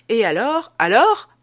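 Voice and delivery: impatient, de plus en plus haut et fort; question pressante. Acted. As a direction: presser l'autre de raconter la suite. Adolphe presse le rêveur de continuer. (« alors » lancé fort, en montant)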